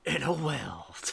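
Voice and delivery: movie trailer whisper. Pretend.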